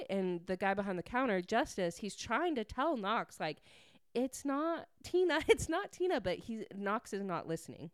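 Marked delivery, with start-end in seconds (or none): 4.1-6.2 high-pitched, insistent correction, acted out; urgent protest. Justice trying to tell Knox, high urgent protest. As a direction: pipe up in mock panic to set them straight. Bystander frantically correcting the mistaken man.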